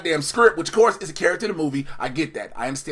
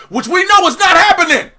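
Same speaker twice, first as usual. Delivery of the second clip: higher, louder outburst; indignant shout; 9 semitones higher, 6 dB louder than usual. Real, not acted. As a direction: snap it at the writers. Ranting at the show.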